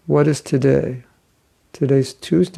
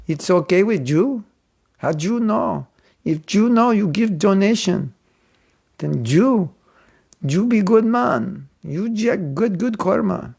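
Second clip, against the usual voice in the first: hammed-up Mexican-accented English, raised and pleading; 5 semitones higher than usual. Poor monk asking a passer-by for more donation, Mexican accent. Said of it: "eh, good Mexican accent".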